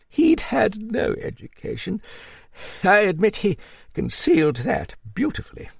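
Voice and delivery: put-on high-pitched hearty old dame; old Duchess. Narrator voices the old Duchess.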